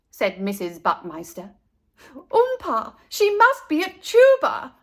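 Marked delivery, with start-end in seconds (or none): 2.1-4.8 high, loud eager guess; excited realization. Acted. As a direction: blurt out the idea.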